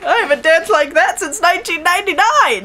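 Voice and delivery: mock exclamation. Voices a character's exclamation.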